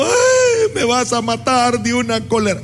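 high wailing mock sob on "Ay", then the words; the son retells how mom cried while scolding him; imitating crying mother. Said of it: "Y ella terminó llorando".

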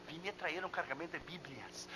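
acted low-key confidential line. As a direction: say it discreetly, keeping it down. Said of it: "¿No puedes hablar un poco más bajo".

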